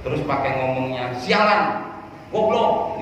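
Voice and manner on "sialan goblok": high-pitched acted insults; angry cursing. Someone cursing over a trivial matter, angry. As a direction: spit out insults in a raised, irritated pitch.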